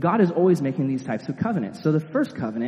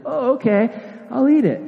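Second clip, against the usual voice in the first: high, mock-naive yes; gullible voice; 10 semitones higher, 4 dB louder than usual. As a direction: play the dupe in a high silly voice. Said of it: "I probably wouldn't be like".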